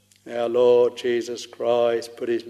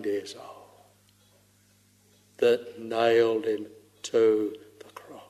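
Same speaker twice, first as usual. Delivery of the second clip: softer, hushed and grave; solemn whisper; 3 dB quieter than usual. Acted. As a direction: confide it in a whisper. Solemn confession of sin.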